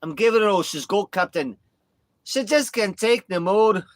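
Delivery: loud, high, hammed-up Scottish cry; Scottish accent. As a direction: strain like a desperate Scottish engineer. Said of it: "I love Scottish accent".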